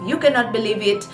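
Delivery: ordinary delivery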